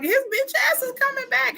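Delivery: very high frantic warning; mock-panicked high-pitched insistence; Laurie from Halloween. Film heroine warning disbelieving people, frantic. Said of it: "like Laurie in Halloween".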